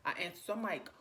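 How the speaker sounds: normal talking voice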